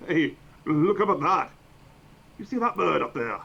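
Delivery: calling out to someone. Acted. Player's character calls a stranger's attention to the bird, loud and high. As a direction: point it out loudly to a passerby. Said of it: "be like".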